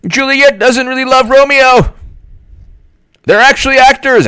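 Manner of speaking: high, mocking know-it-all whine; know-it-all theatergoer. Mocking a theatergoer who heckles the play, high whine. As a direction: pitch it up high to mock a pedant in the audience. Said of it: "You don't say".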